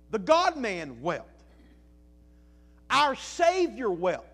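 slow, loud preaching build; slow preaching refrain. Acted. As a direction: lean on each word, building fervor.

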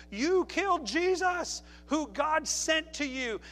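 slow, high, accusing cry; a hint of a martyr's rebuke; Stephen accusing. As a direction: lay the charge on them slowly. Stephen accusing his killers.

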